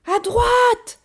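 very high, loud yell; shouted directions. A narrator's reading. Child repeats the direction to the gorilla driving, yelling high. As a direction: holler it so the driver hears.

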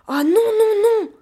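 loud, high cry of alarm; shouting. A narrator's reading. Gorilla rushes at the escaping child, shouting. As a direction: cry out in protest as you lunge. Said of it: "cria la gorille en se ruant sur moi".

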